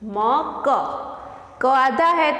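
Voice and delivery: ordinary talk, nothing marked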